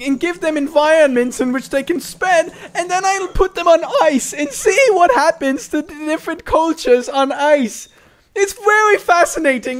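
goofy author voice. Acted. Mocking the friend's long guide reading, goofy author voice. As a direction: ramble pompously in a high goofy voice. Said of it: "It's just a goofy voice".

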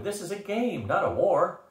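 high-pitched exasperated protest; exploding in exasperation. A narrator's reading. Leader telling generals it is only a game, exploding. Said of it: "I exploded".

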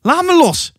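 very high-pitched cry to be released; shriek. A narrator's reading. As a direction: shriek to get free of a grip. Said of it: "gilde Hasse". (hoge gil)

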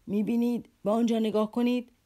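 bellow, pointing down in rage; furious roar. A narrator's reading. Captain roars again, pointing at the notes, enraged. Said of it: "باز هم با اشاره به آنها خروش برآورد".

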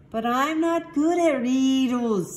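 high, loud little protest; worried protest. A narrator's reading. Kitty protests the riddle task.

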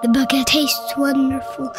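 ordinary delivery

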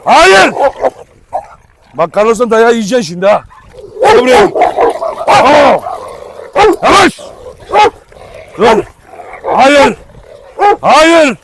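panicked yelling at dogs; alarmed shouting. Real, not acted. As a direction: bark commands to stop the fight. Breaking up a dog fight.

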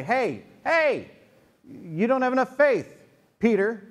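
hint of a scolding teacher; scolding Jesus.

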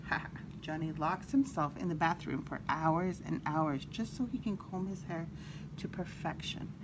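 ordinary delivery, nothing marked

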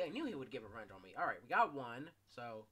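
ordinary delivery

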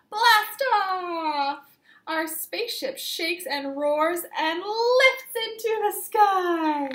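loud, high launch cry; excited blast off. Acted. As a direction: shout it like the rocket is lifting off. Rocket launch in read-aloud story.

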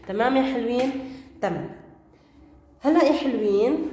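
ordinary talk, nothing marked

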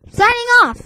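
high, loud cheer; excited. Real, not acted. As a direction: sign off with a cheer. Cheery sign-off.

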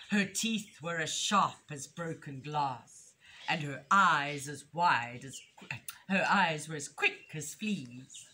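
animated picture-book reading with punched key words; story reading to children. A narrator's reading. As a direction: read it expressively, building suspense for small listeners.

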